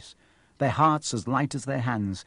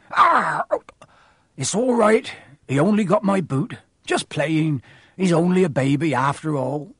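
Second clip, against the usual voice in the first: Hagrid; 4 semitones higher, 3 dB louder than usual. Acted. Hagrid brushes off dragon bite.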